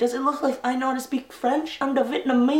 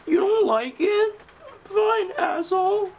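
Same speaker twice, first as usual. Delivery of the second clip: offended; 8 semitones higher than usual. Acted. Caroler storms off offended.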